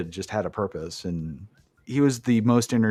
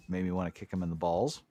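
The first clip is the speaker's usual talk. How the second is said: normal talking voice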